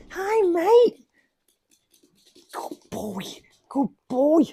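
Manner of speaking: high, sing-song voice for the dog; cooing to the dog. Real, not acted. Owner cooing a greeting to his little dog. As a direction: coo a hello to your pet.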